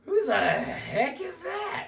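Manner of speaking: loud, high-pitched drawn-out demand; slow, high yell of shocked disbelief; dad's incredulous outburst. Retold or imitated. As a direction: imitate a dad bursting out in disbelief. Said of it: "Dad comes in and goes".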